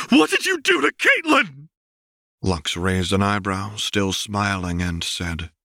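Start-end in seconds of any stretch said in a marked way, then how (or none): 0.1-1.7 much higher, louder cry of alarm; shrill desperate yell; frantic shout. Acted. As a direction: demand it in panic. Vi spins on Lux in alarm.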